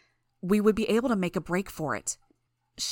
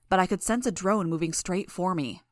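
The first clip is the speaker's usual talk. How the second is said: ordinary delivery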